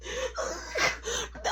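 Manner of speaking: wheezing, squeaky laugh; a real helpless laugh at yourself; high breathless laugh. Real, not acted. Storyteller cracking up at own panic, laughing breathlessly.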